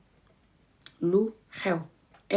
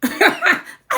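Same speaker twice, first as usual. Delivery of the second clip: laugh; 10 semitones higher than usual. Real, not acted. Laughs at own example.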